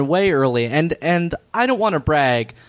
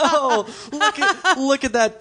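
laughing; 11 semitones higher than usual. Real, not acted. Laughing aside.

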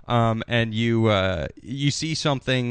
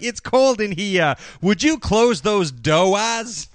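slow, higher, hammed-up New England accent; overdone Boston drawl, louder; bad New England accent; 7 semitones higher, 4 dB louder, much slower than usual. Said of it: "do your New England accents".

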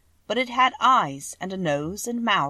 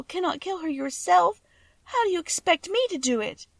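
tearful pleading; 11 semitones higher, 5 dB louder than usual. A narrator's reading. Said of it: "she sobbed".

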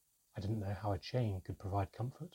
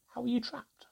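very high, eager question; quick question. A narrator's reading. Narrator asks mermaid how she was trapped, eager. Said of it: "I asked quickly".

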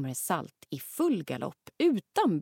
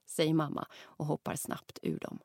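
plain reading voice

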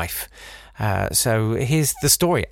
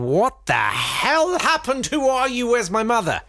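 put-on much higher, slow confused child; 12 semitones higher than usual. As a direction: squeak it like a bewildered little kid. Children voiced in story.

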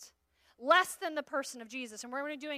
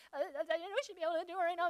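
fast, stuttering mock panic, softer; panicked stammer; 2 semitones higher, 5 dB quieter, faster than usual. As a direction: reenact babbling in a fear-shaken rush. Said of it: "talking like this".

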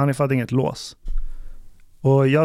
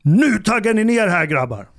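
booming order to calm the guys down, then dropping off; retold stern bass shout to the guys to calm down; loud bass command; 5 semitones higher than usual. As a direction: bark it out like an ex-cop telling young men to settle. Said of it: "Men så här basröst, skithögt".

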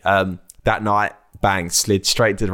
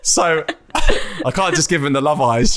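slow, higher, giggling through it; laughing; 6 semitones higher than usual. Real, not acted. Joking with the host about love eyes, slow and laughing. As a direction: tease yourself while laughing.

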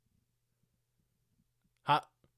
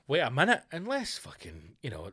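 ordinary delivery

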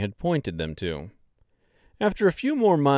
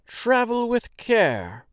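much higher, slow little-person voice; Munchkin farewell call; 12 semitones higher, slower than usual. Acted. Villagers wave off the strangers, slow high call. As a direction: sing it out kindly. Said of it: "shouted things like".

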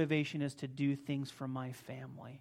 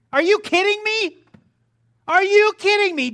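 outraged disbelief; 21 dB louder than usual. Real, not acted. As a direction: shout it high and loud, incredulous. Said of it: "most ridiculous thing I've ever read".

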